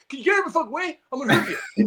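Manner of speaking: mock high-pitched little tough guy threat; squeaky-high imitation of an angry threat; Jordan. Co-host imitating him threatening a huge linebacker, mock-tough and high. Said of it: "And Jordan, Jordan looks like".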